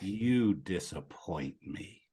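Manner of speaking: very slow, low and hushed; disappointed father. Acted. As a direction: deliver a heavy, quiet verdict.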